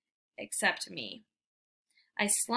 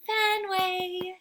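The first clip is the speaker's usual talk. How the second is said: much higher, coaxing call; sweet voice; 10 semitones higher than usual. Acted. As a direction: lure the dog over gently. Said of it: "she calls in a sweet voice".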